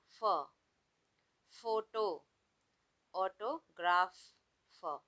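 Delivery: फॉन, डॉल्फिन, एलिफेंट, नेफ्यू, फोटो, ऑटोग्राफ said with a plain f sound, then फ on its own; teacher isolating the f sound. Shown as a demonstration. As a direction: stress the फ sound at each word. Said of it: "हमने इसको कैसे pronounce किया है?".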